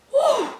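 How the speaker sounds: very high-pitched woo; high celebratory whoop. Real, not acted. Fan relieved the touchdown stands, high whoop.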